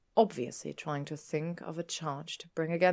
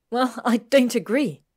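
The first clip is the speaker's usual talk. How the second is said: loud, high, snappish objection; snapped; 7 semitones higher, 12 dB louder than usual. A narrator's reading. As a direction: snap back, refusing to agree. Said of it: "Hermione snapped".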